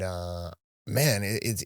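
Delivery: ordinary talk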